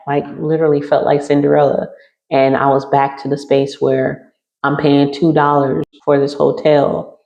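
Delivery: normal talking voice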